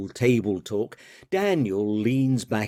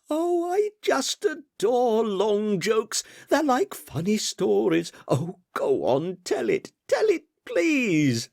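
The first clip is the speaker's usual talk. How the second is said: very high, eager pleading; begging; 12 semitones higher than usual. A narrator's reading. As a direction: gush and beg for the joke. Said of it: "begged Betteridge".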